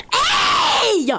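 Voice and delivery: high, sudden pretend shout; calling out. Parent catches child undressing, high shout. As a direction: yell a sharp telling-off. Calls out at baby.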